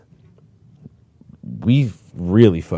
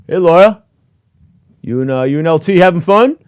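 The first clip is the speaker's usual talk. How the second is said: loud, high teasing call across the gym; mocking sergeants calling out; 10 semitones higher, 9 dB louder than usual. Sergeants ribbing Loya in the gym, loud high teasing call. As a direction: yell it up high to rib him. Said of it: "Sergeants come over and look over".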